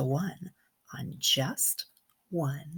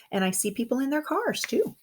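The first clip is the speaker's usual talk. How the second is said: plain reading voice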